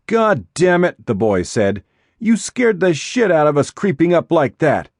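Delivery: loud, high angry yell; furious, caught off guard. Acted. Startled boy yells at intruder.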